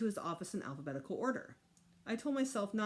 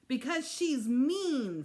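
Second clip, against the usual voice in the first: called out; 7 semitones higher, 8 dB louder, much slower than usual. Acted. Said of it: "Fudge called".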